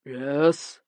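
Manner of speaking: a hint of booming actor; clipped curt reply from a proud actor; understated theatrical voice; mild stentorian. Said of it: "his voice stentorian".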